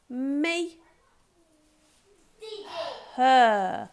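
each word said clearly, one at a time; teacher reading flashcards. Shown as a demonstration. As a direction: read each tricky word aloud for the children. Reads tricky words aloud to class.

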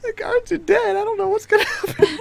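very high, squealed through laughter; helpless laughter. Real, not acted. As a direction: lose it completely while talking. Game master cracking up, guards are dead.

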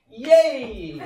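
loud, high, fake-happy cheer; sarcastic cheer.

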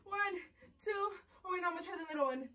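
soft, high anxious countdown; nervous whimper. Real, not acted. Vlogger psyching herself up to rip wax, nervous whimper. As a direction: count timidly, dreading the pain.

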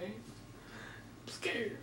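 whispered fear, barely voiced; hushed mock fright; scared whisper. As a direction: whisper it like you're hiding.

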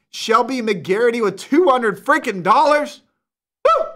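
excited. Real, not acted. Excited donation shoutout.

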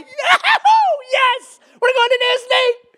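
high, loud, overjoyed cheer; excited whoop. Acted. Dad pulling into the Disney gates, acting out excitement. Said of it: "get excited".

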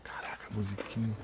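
startled hushed whisper; whispering while hiding. Real, not acted. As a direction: whisper in alarm at the music. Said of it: "Fazer o mínimo de barulho possível".

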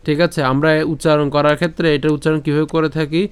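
ordinary talk

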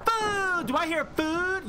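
high excited call. Real, not acted. Hungry streamer spotting food, high excited call. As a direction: jump at the word food.

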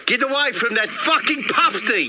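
high, angry South African yell; South African shout. Acted. Kiosk bloke warns tourist off a penguin, South African shout. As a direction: bellow a warning in broad South African. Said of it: "this voice, South African".

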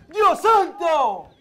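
long, high, very loud cry; anguished shout. Real, not acted. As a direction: scream it out in disbelief. Penalty missed, commentator cries out.

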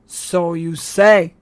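slow doubting growl. A narrator's reading. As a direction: drag it out with suspicion. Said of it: "the sergeant growled".

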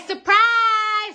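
sudden high, loud "Surprise!"; hammed-up shout of "Surprise!"; mock God shout. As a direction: fling it out as God's punchline. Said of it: "God said".

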